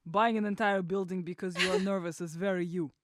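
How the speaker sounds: Russian-accented quote in a borrowed voice. Shown as a demonstration. Reading Ilya's quote on request, in a Russian accent. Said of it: "better when you do it in your accent".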